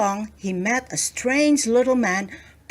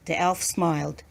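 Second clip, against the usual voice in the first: ordinary delivery, nothing marked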